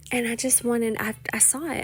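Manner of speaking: normal talking voice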